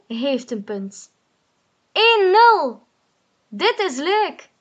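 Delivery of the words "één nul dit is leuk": high, loud triumphant cheer. Acted. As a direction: crow about the goal. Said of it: "roept Boe".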